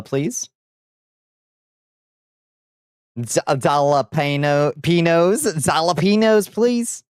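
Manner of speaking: gringo ordering Mexican food; American accent Spanish. Retold or imitated. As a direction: mangle the Spanish words like a tourist. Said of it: "my aunt ordering at a Mexican restaurant".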